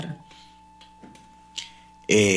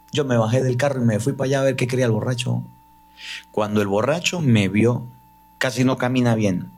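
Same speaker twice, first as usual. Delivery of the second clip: ordinary talk, nothing marked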